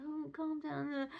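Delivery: soft, slow, mock-soothing coo; mock mollycoddling. Mum mocking how she'd coddle the crying toddler, soft and slow. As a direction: coo it like you're babying her, half-mocking. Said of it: "I haven't got the time to sit and".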